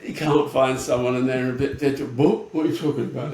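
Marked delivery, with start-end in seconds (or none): 2.1-3.3 loud, dismissive retort; mock a dismissive shop worker. Shop worker brushing off a customer who needs help, dismissive. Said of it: "They're just dismissive".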